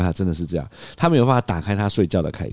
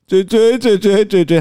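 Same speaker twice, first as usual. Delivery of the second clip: higher, louder, slow babble; imitating toddler babble; 12 semitones higher, 6 dB louder, slower than usual. 模仿孩子重复. (高声慢速模仿小孩)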